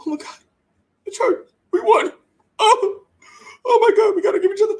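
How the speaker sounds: high, squealing mock celebration; parodying Cowboys players gushing over beating the Chargers; mocking overjoyed players. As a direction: ham up the teary joy of a small win.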